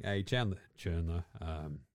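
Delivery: low, quiet mumbling coach; a coach quietly handing the play over, low and mumbled; Belichick impression. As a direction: mumble it low and deadpan like a weary old coach. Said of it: "Belichick just going".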